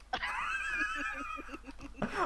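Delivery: shrieking laugh. Real, not acted. Laughing at Marine's reaction.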